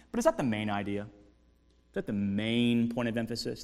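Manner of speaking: usual speaking voice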